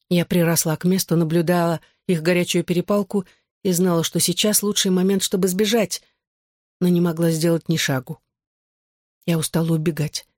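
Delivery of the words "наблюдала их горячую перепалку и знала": usual speaking voice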